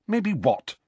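impatient high snap; snappish. Acted. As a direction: cut in, annoyed. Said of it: "said Hermione rather snappishly".

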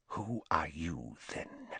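soft, low, almost whispered question; cold quiet demand; low menacing hush. Acted. Riddle confronts drunk uncle.

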